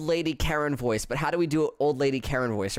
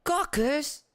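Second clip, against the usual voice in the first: put-on high old lady voice; 11 semitones higher than usual. Voicing guard.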